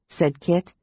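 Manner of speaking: ordinary delivery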